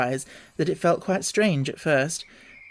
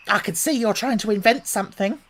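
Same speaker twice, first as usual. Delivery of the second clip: high, put-upon bird voice; pitched-up pigeon grumbling; fretful pigeon voice; 6 semitones higher than usual. A narrator's reading. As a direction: complain like a harried mother bird. Pigeon suspicious.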